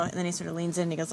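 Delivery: usual speaking voice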